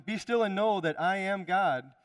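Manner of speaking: preacher's full-voiced declaration of the verse; proclaimed verse. Shown as a demonstration. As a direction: declare it to the whole room.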